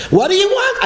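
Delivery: very high-pitched mock protest; exasperated institution official. Institution defending itself on the phone, exasperated high-pitched protest. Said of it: "with an institution".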